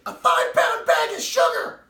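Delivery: high, loud frantic shout; yelling down the phone; retold shout. Wife on the phone shouting in panic. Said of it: "She says".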